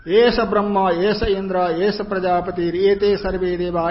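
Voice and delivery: loud, ringing Sanskrit chant; forceful intoned quotation; scripture recitation. Real, not acted. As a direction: project the sacred verse well above talking volume.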